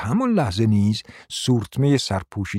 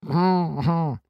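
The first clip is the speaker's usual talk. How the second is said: dull grunt; 6 semitones higher, slower than usual. A narrator's reading. Dim husband grunting at his wife, slow and dull. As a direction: grunt like a simpleton.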